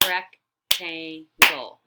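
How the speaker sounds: Rectangle drawn out very slowly, one beat per syllable; clapping syllables. Shown as a demonstration. Teacher clapping syllables for the class, slow. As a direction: split Rectangle into three slow claps. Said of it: "Let's clap rectangle".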